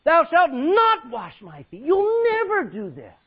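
loud, high and slow; adamant refusal. Acted. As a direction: refuse with full force. Peter refusing, acted.

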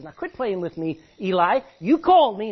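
put-on the boy Samuel, fed up; annoyed boy. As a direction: protest to the old priest. Samuel complaining to Eli.

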